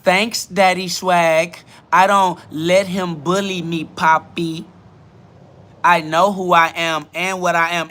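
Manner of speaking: slow mock accent of a commenter; deliberate put-on accent; Demon Time's accent. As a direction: imitate the commenter's accent, drawing words out. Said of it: "I didn't say it in that accent".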